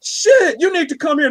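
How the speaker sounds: high, loud crying-shout dog voice; overjoyed dog. Pretend. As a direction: wail like a dog that missed you, high and loud. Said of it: "a dog is like".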